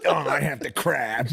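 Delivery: slow, drawn-out mock whine; impression of a helpless whine. Friend mocking him, a slow drawn-out whine. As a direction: whine it out slowly like a helpless patient. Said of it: "did an impression of me".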